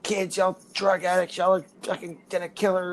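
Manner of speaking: mock the furious cousin chewing us out; angry cousin. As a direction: cousin yelling at the stupid kids, put-on angry voice. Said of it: "and she's like".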